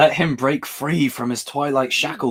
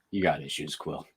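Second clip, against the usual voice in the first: low, soft offhand delivery through a laugh; mock the careless tone; nonchalant; 8 semitones lower, 12 dB quieter than usual. Imitating the character's nonchalant line to Quill. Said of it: "says it so nonchalantly".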